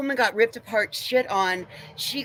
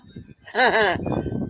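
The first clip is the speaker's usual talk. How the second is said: bitter laugh; 4 dB louder than usual. Real, not acted. Bitter laugh mid-rant.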